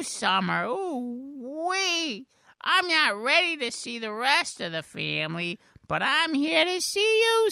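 very high cartoon voice; high, drawn-out silly voice; Mister Poopy Butthole. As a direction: squeal it slowly like a cheery cartoon creature. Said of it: "Mister Poopy Butthole".

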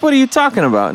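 high-pitched mock bafflement; retold baffled reply. Host replays his reply to his wife, retold bafflement. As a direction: act out being thrown by an odd claim.

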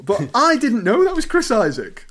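thrilled by a discovery; delighted surprise. Real, not acted.

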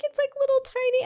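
put-on high small child voice; little girl voice. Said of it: "they're like".

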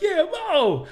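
high, loud call of a name; impatient crowd yell. Retold or imitated. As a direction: shout it like a fan tired of waiting. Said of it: "this guy goes".

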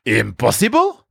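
"Impossible" as a rising, delighted question. A narrator's reading. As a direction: challenge the word, lifting the end. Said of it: "Anatole jumped up and danced".

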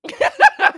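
high loud burst of laughter. Real, not acted. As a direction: laugh out at yourself. Laughs at own quote.

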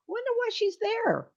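high puzzled. Retold or imitated. Voicing own puzzled thought.

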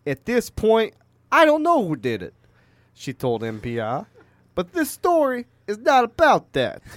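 put-on high-pitched Italian-American gangster accent; Tony Soprano. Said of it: "Tony Soprano".

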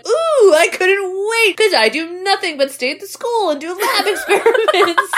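high, giddy nerdy teacher. Retold or imitated. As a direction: gush like a lab geek. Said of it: "science teacher's like".

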